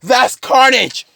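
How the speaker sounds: high, loud, drawn-out cry; shocked. Acted. Spotting Carnage's face, shocked. Character recognises the villain's face.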